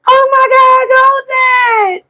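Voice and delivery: high, loud, thrilled cry of a friend's name; excited squeal. Retold or imitated. Overjoyed on the phone with an old friend, excited squeal. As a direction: gush with joy at hearing her again.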